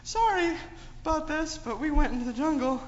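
high, whiny plea to Mom with a sigh; pleading kid voice. Acted. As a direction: beg Mom for help like a guilty child. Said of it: "and they're like".

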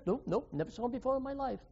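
fast, soft, jittery brush-off; nervous rushed denial. Acted. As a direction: rattle it off like you want to escape. Peter denies a second time.

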